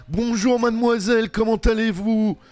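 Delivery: high, loud sing-out welcome; effusive greeting. Acted. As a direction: gush over a favourite customer. Effusive greeting to returning customer. (accueil très enjoué)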